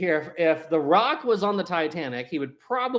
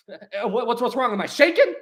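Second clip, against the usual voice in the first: high, stammering, worried questions; rising comic alarm; mock panic; 6 semitones higher than usual. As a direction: imitate someone panicking, amused underneath. Acts Kevin's panic after eating cake.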